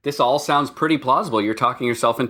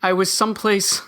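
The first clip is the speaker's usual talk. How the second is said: a lightly voiced confused ghost, higher; 4 semitones higher than usual. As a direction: drift off like a lost spirit. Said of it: "like my ghost voice?".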